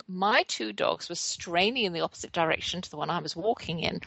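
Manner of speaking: ordinary talk, nothing marked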